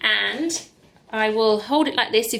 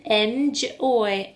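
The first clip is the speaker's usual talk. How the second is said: ordinary talk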